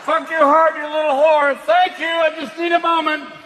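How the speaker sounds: loud hammy actor; actor on set. Retold or imitated. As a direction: ham it up then get precious. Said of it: "on a porn movie set going".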